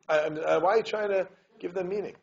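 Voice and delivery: ordinary delivery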